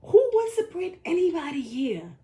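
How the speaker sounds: high-pitched, incredulous challenge; real indignation, voice jumping high; indignant retort. Real, not acted. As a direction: throw the question back, pitched right up. Indignant retort in a couple's dispute.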